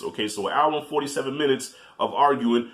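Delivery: ordinary delivery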